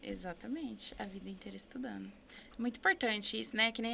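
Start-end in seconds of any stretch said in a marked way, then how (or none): none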